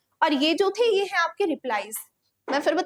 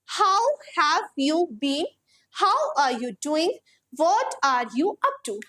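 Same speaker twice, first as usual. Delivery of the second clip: each word spaced out, slow and clear; slow model sentences; much slower than usual. Shown as a demonstration. Teacher modelling phrases for students, slow and clear. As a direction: read it slowly so students can copy. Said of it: "teacher models sentences slowly".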